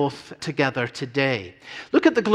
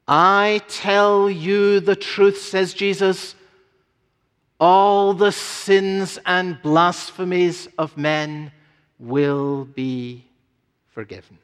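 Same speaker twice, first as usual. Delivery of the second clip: louder, slow, pausing before forgiven; said with emphasis; 3 semitones higher, 4 dB louder, slower than usual. Shown as a demonstration. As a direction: proclaim it, letting forgiven fall away. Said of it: "Isn't this a glorious promise".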